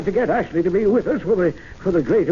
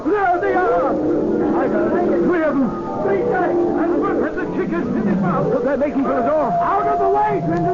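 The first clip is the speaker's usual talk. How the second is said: frantic high shouts as the tigers burst through; excited shouting; 10 semitones higher than usual. Acted. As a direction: yell the warning across the room. Calling out as tigers charge.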